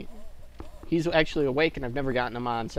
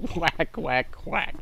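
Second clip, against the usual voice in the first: playful quacking duck; much slower than usual. Pretend. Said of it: "this horrible duck in here".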